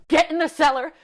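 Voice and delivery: loud, high urgent order; mother yelling. Retold or imitated. Mama to the children in the storm, yelling. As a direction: yell it as an emergency command. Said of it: "Mama yelling".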